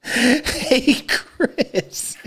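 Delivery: put-on high child's greeting, trailing into breath; little kid. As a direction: imitate a small boy starting up. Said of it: "five minutes later".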